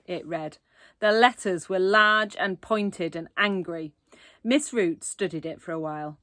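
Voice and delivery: usual speaking voice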